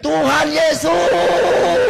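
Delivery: full-force high shout, stretched very long; teriak. Retold or imitated. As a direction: belt out a long, high cry to God. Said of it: "Saya teriak". (berteriak sekuat tenaga, panjang)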